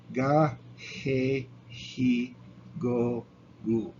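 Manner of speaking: "Ga, he, he, go, gu" with "he" spoken like an English h; Spanish g syllables. Shown as a demonstration. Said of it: "an English H".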